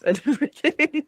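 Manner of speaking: words broken by a laugh. Real, not acted. Laughing at own botched throw.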